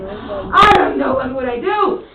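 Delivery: slow theatrical shout; exaggerated intonation. Shown as a demonstration. Teacher models for the class, exaggerated slow shout. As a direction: cry it out big, slow and loud. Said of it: "I want you to exaggerate".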